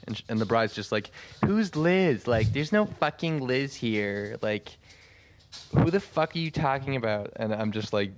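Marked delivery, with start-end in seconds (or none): 1.5-7.3 imitated bride, high-pitched and incredulous; angry disbelief. Bride disputing the workers' story about their boss, angry disbelief. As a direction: demand answers like an outraged bride.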